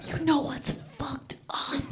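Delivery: soft whispered aside, slowed; confiding whisper. Real, not acted. Comic confiding in the audience, in a slow whisper.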